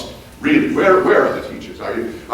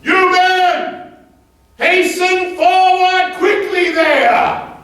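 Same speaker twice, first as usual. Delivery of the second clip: much higher, slow, drawn-out shout; stirrup-high yell; much slower than usual. Retold or imitated. Rancher standing in stirrups yelling at slow cowhands, a high drawn-out shout. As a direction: bellow it across the hills at the dawdling men. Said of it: "and I yelled out to my men as loudly as I could yell".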